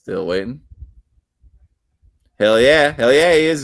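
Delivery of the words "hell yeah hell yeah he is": loud, high "Hell yeah"; triumphant shout. Acted. Declaring Andy a Frenchman with a loud triumphant shout. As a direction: bellow it like a winner.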